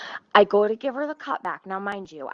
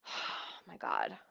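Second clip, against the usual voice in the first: exasperated sigh; 13 semitones lower, 12 dB quieter, slower than usual. Real, not acted. Exasperated aside mid rant.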